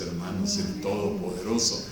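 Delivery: ordinary delivery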